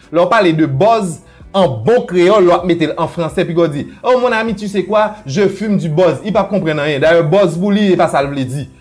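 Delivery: ordinary talk, nothing marked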